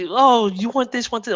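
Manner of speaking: lightly hammed-up polite host; mock over-politeness. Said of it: "they're over polite down here".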